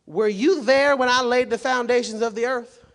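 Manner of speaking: high, loud, booming divine question; God's challenge. Acted. God confronting Job from the whirlwind, booming challenge. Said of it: "He says".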